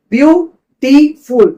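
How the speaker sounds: বিউটিফুল said slowly as beau - ti - ful; three separate beats on বিউটিফুল, a gap after each; broken in three. Shown as a demonstration. Teacher showing it must break thrice, বিউটিফুল in slow syllables. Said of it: "তিনবার তোমাকে ভাঙতে হবে".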